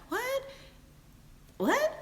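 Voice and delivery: very high squeak of disbelief; shocked what. Retold or imitated. Mistress hearing the man lives with her, shocked squeak. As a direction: sound like you can't believe it.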